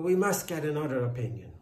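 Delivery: loud, quick, anxious insistence; hurried, worried demand. A narrator's reading. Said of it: "said the anxious farmer".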